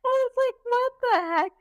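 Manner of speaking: very high squeal through laughter; high, laughing mock bewilderment; laughing disbelief. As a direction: retell your shock, helpless with laughter. Laughing while retelling own shock.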